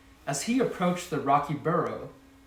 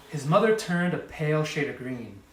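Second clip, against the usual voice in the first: ordinary delivery, nothing marked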